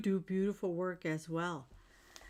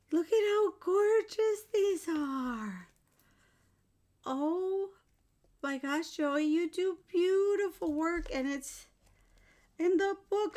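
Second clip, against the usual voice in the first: high-pitched, gushing amazement; real excitement; 8 semitones higher, 4 dB louder than usual. Real, not acted. Praising Joey's work in front of viewers, gushing excitement. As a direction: gush with the pitch lifted high. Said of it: "I'm so excited".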